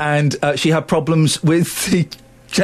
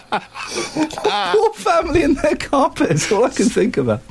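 high, giggling sorry; laughing helplessly at the ruined carpet; laughing apology; 10 semitones higher than usual. Real, not acted. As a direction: apologise but keep cracking up. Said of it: "you're in a funny mood".

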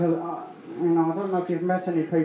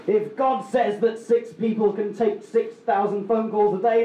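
ordinary talk, nothing marked

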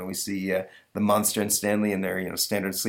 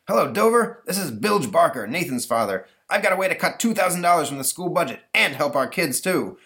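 higher, forceful angry-dad voice; pushy, raised-voice caller; blustering Bilge Barker; 9 semitones higher, 4 dB louder than usual. Acted. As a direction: pitch your scheme like a pushy father.

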